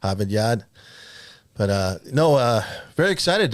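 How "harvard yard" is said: put-on Harvard Yard with dropped r's, Boston accent. Cohost doing the Massachusetts accent on request, Boston accent. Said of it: "Do the Massachusetts accent so I can understand you".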